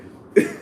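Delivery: high-pitched burst of laughter. Real, not acted. Laughing after mocking the phone call, high laugh. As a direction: cackle loud and high.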